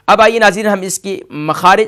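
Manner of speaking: ordinary talk, nothing marked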